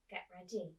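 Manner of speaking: ordinary delivery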